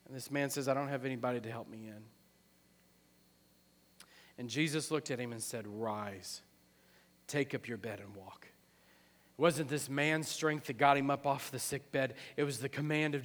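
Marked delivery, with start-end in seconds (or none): 0.7-2.0 low, soft and quick; hushed, defeated admission of the sick man; subdued reply. Acted. Voices the sick man's reply. 5.7-8.4 low, soft authority; hushed, solemn word of healing; quiet command. Acted. Voices Jesus healing the man.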